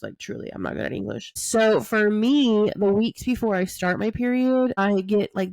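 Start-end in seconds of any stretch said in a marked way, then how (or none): 0.0-1.3 whispered aside. Real, not acted. Self-deprecating aside after fumbling words.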